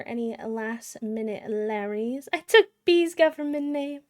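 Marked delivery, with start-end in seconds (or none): 2.3-4.0 mock villain voice. Voicing a chat joke.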